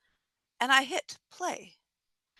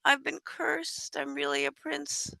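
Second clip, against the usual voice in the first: higher, softer puppy-dog plea; pleading wolf; 5 semitones higher, 5 dB quieter than usual. Acted. Cursed wolf to the person in the tree, gently pleading. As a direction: beg sweetly, like a cursed creature. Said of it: "with those puppy dog eyes and says".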